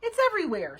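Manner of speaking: loud, high exasperated snap; sharp outburst of annoyance; angry snap. A narrator's reading. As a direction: snap at Bean, voice leaping up. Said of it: "snapped Nancy".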